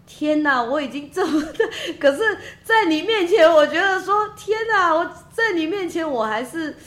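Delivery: high, loud, voice catching between laugh and cry; fighting back tears with a half laugh; laughing through tears. Real, not acted. Recalling emotion, voice breaking.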